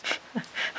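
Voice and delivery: a quick breathy giggle at her own excitement; a short giggle over pretty cards; excited giggle. Real, not acted. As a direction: laugh softly, caught up in the moment. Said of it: "Don't I get excited?".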